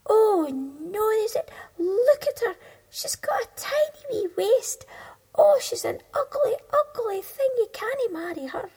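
very high, loud scornful beetles; mocking beetles. Beetles mocking Thumbelina to the beetle, very high and loud. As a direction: sneer at her looks, high and loud.

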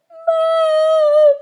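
very high, loud desperate call; crying out. A narrator's reading. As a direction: yell for mum into the storm. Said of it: "he cried".

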